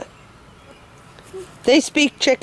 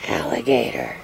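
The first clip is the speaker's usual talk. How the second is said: deep mock voice; 14 semitones lower, 7 dB quieter than usual.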